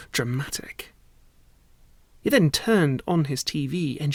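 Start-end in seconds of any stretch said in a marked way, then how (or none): 0.1-0.9 whisper. A narrator's reading. Narrator whispers dramatic word.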